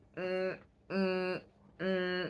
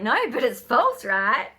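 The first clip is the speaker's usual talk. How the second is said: gloating; 5 semitones higher, 8 dB louder, slower than usual. Retold or imitated. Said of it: "And I'm like".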